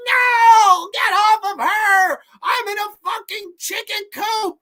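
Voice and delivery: high, loud, mock-desperate cry from a trapped man; mock desperate yell. Boyfriend locked in the chicken coop, yelling in mock despair. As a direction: wail like a man locked in a cage. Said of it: "And the guy's like".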